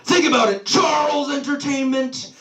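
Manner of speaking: high-pitched, exasperated imitation of yelling; mock yell. Brother yelling a clue at baffled sibling, mock furious. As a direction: shout it as the fed-up brother, pitch over volume. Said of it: "he yells at me".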